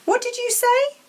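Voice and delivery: high, loud snap; aunt snapping through the door. Acted. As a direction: snap back suspiciously. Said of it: "his aunt snapped through the door".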